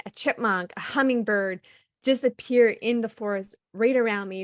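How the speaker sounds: normal talking voice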